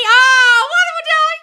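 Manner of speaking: very high, loud gushing praise; gushing delight. Real, not acted. Host gushing to a viewer about their podcasts, very high and loud. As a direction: swoop way up and gush over the comment.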